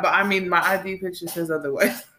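ordinary delivery

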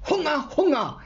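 high, insistent creature cry; mock bellow. A narrator's reading. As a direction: chant the demand in a high mock yell. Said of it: "brüllt der Nix".

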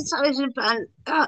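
normal talking voice

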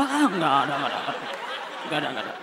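low, quiet fading no; nervous mock denial. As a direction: mumble it away, pretending. Pretending not to see Alex.

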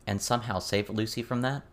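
usual speaking voice

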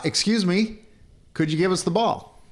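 polite holler to a neighbor; hollered call. Retold or imitated. Calling across the fence to the raking neighbor, polite holler. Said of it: "I hollered across the fence".

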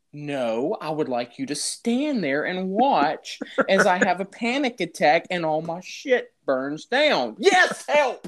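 sarcastic reply building to a high yelp for help; to the neighbor, sarcasm ending in a high cry. Retold or imitated. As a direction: drip sarcasm, then squeak out help.

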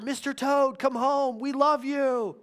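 calling out high across the yard; a father imitating his daughter calling her toad home; retold holler. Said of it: "began to holler".

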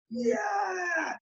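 high yelled Yeah from the crowd; mock yell. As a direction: belt it out like a fan. [name] shouting from audience.

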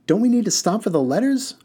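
put-on high, lifted young voice; a curious boy leaning forward to ask; Haroon's voice, high and eager; boy's question. Boy Haroon asks driver.

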